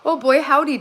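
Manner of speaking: high, loud mock imitation of a son; imitating son's greeting. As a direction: play back his greeting with a grin. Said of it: "[name] said".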